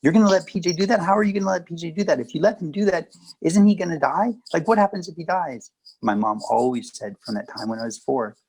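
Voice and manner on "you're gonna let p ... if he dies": high, fast, fretting grandmother; worried grandmother. Retold or imitated. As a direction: mimic the anxious grandmother, pitched up. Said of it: "my grandmother's response".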